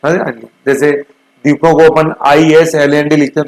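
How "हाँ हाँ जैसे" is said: ordinary talk, nothing marked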